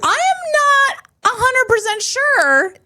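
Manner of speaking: very high, drawn-out hedging; exaggerated uncertainty. Real, not acted. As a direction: stretch it out slowly, way up high. Unsure answer to whether it's legal.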